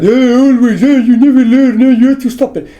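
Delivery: high, loud mock scolding voice; mocking scolding parents. Mocking parents yelling at the dog, high and loud.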